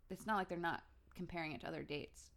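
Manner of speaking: ordinary talk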